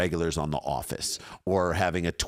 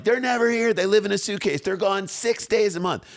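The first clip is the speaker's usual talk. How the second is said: much higher, whiny voice of nagging relatives; mock family complaint; 13 semitones higher than usual. Relatives complaining about the couple always travelling, high whine. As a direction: play the family griping, pitched way up. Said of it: "they're like".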